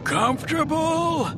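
high, slow croaking voice; croaking alien. A narrator's reading. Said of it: "Beliath croaked".

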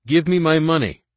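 loud, high furious demand; angry demand. Acted. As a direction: shout it, outraged. Kundratol demands his money.

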